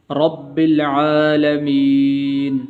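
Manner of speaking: very slow chanted verse; Quran recitation. Acted. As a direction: sing the verse out slowly.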